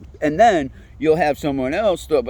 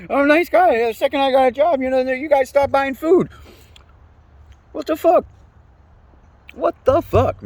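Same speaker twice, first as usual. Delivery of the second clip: high-pitched exasperated rant; 11 semitones higher, 3 dB louder than usual. Real, not acted. Ranting about family not buying food.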